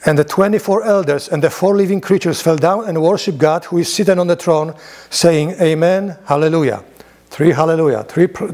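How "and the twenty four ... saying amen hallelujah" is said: raised, solemn reading voice; scripture reading. Acted. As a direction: read it out like scripture to the room. Reads Revelation aloud to class.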